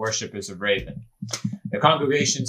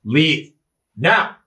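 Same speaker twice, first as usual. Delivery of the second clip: loud, curt command to back off; commanding threat; 3 semitones higher, 6 dB louder than usual. Acted. Turning the spear on the slinger, commanding threat. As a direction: punch it out as an order.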